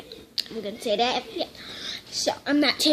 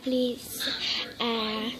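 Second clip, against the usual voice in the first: ordinary talk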